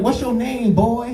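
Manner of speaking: high, hammed-up master voice; mock slave master. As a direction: bark the question like a plantation owner. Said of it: "I'm like".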